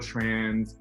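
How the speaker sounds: ordinary talk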